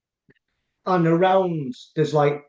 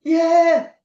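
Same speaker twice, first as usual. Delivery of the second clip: emotional high exclamation; 10 semitones higher, 4 dB louder, slower than usual. Real, not acted. Moved by the cuddles recalled.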